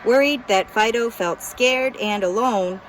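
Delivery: plain reading voice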